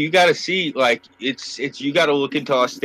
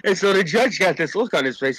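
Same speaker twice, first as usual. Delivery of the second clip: ordinary delivery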